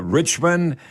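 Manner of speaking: ordinary talk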